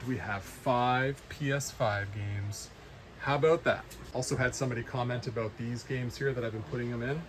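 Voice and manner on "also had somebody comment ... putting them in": ordinary talk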